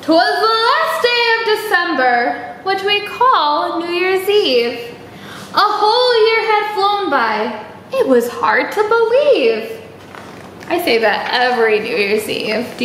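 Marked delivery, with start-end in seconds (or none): 0.0-9.7 lightly lilting storybook voice; reading to children. Acted. Teacher reading a New Year's story to kids. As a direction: read it brightly to little listeners.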